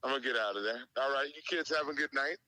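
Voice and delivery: normal talking voice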